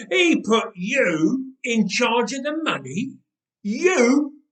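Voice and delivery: can't believe it, mock him; mocking disbelief. Thug mocks disbelief.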